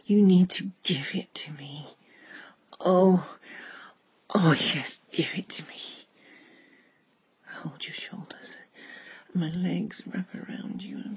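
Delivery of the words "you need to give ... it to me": urgent pleading. Acted. Pleading in intimate roleplay.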